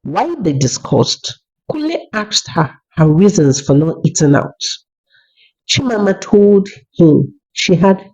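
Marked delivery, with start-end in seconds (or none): none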